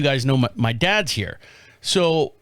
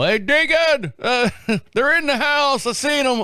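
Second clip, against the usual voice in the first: dad calling out; 9 semitones higher than usual. Retold or imitated. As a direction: call out to your son like the dad. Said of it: "my dad goes".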